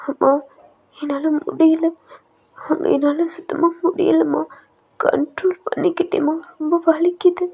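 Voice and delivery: tearful plea. Acted. Daughter breaks down in pain.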